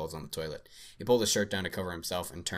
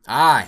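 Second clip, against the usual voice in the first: sharp loud cry of alarm; startled yelp; 6 semitones higher, 16 dB louder than usual. Acted. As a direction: yelp as the truck lurches. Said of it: "he added, helpfully".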